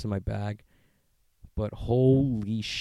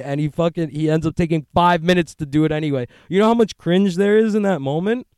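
mock outrage; 6 semitones higher, 8 dB louder than usual. Rant continues at Biden.

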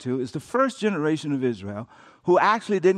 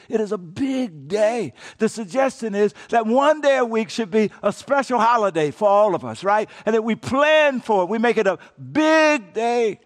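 usual speaking voice

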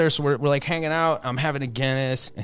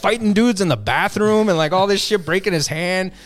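excited; 10 semitones higher, 5 dB louder than usual. Real, not acted. Excited telling of Flair's fight.

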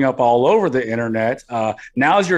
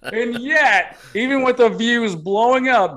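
mock indignation; 8 semitones higher than usual. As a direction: act wounded and indignant. Mock outrage build-up.

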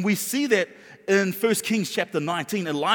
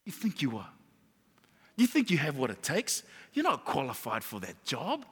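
low, soft, doubting taunts; devil's belittling voice; 5 semitones lower, 7 dB quieter than usual. Acted. Devil making a believer doubt themselves, low and belittling. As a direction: undermine the listener quietly. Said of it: "the devil will try to make you doubt".

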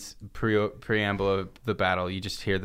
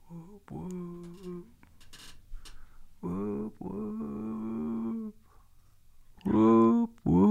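distant whoop calls; 12 semitones higher, 5 dB quieter, much slower than usual. Retold or imitated. Juggalos approaching from far off.

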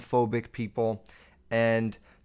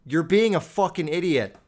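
loud, higher call-out at a stranger; scolding shout; 4 semitones higher, 4 dB louder than usual. Retold or imitated. Telling off a maskless old man, a loud scolding shout. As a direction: yell it at him from a distance. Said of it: "you gotta be like".

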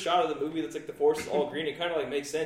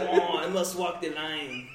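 slow, laughing bad French accent; drawn-out hammy French accent; much slower than usual. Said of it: "terrible French accent".

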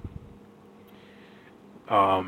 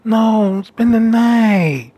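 higher, whiny begging to stay; mock clingy pleading; 6 semitones higher, much slower than usual. Clingy hookup begging him to stay, mock whine. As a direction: plead with a leaving guest, stretching "spend the night". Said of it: "I hate that when I'm over".

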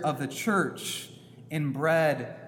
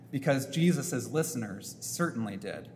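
usual speaking voice

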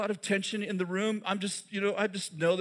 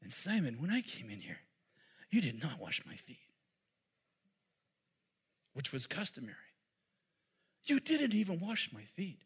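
hushed rebuke; 4 semitones lower, 8 dB quieter than usual. Acted. Preacher dropping to hushed rebuke.

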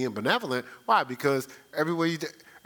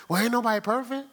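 mock excuse; 7 semitones higher, faster than usual. Imitating excuse-making believer.

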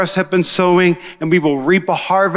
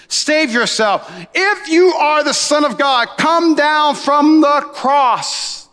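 higher, drawn-out slow jeer; mocking taunt; 8 semitones higher, much slower than usual. Passersby taunting Jesus on the cross, slow high jeer. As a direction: sneer up at him on the cross. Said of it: "then began to mock him".

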